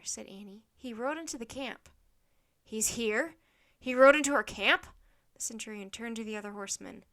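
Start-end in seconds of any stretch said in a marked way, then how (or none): 2.6-4.8 incredulous question, building to very loud; alarmed echo. A narrator's reading. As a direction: repeat it back, outraged disbelief rising. Centurion echoes Annie in alarm.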